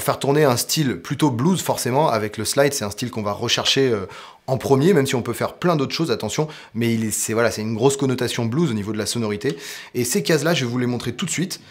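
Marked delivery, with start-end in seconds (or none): none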